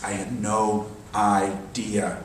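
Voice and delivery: soft, very slow, spaced-out words; hushed, drawn-out imitation of amazement; retold amazement. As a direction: let each word drop quietly, as if astonished. Classmate's awed report retold.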